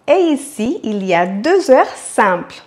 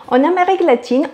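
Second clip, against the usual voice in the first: ordinary talk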